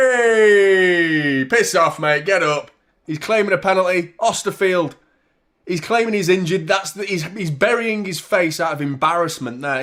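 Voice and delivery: loud, high-pitched match commentary with an outraged shout; heated play-by-play. Real, not acted. Host shouting down a penalty claim, heated live commentary.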